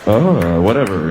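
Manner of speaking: low, indifferent moan; bored moan. Acted. Man moaning half-heartedly while nipples are touched. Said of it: "holding pattern bullshit moan".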